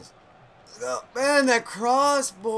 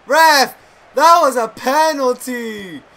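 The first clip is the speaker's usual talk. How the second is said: yelling at the ref; 5 semitones higher, 12 dB louder than usual. Real, not acted. Yelling at the referee.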